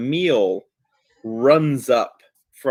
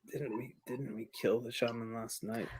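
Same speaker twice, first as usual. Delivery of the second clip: hushed question; 16 dB quieter than usual. Acted. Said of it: "Valdrin says".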